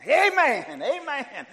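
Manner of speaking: high shouted amen with a laugh; joyful shout. Real, not acted. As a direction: burst out in joy. Preacher erupts with joy.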